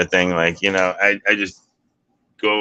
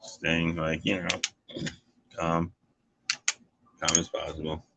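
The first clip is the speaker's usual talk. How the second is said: much softer, settling down; quiet, subdued; 3 semitones lower, 11 dB quieter than usual. Real, not acted. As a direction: drop the voice low and calm. Describing keeping calm.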